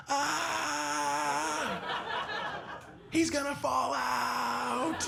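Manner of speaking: high, slow laughing squeal; high, slow mock girlfriend squealing at a dog in a car; imitating girlfriend squealing. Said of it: "She'd be like".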